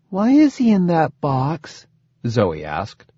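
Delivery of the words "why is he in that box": put-on high-pitched small child's voice; little girl. A narrator's reading. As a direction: ask like a curious little girl. Zoe, small child, asks father.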